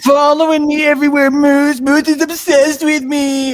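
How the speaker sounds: high, loud whiny voice; mock whiny complaint. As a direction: whine it like a pestered friend. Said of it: "is what I would say".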